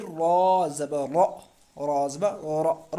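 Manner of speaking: slow drill of र with a thick, full ra; slow recitation of a thick र; heavy ra. Shown as a demonstration. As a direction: draw out र heavily. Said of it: "रा को हम मोटा पढ़ते हैं".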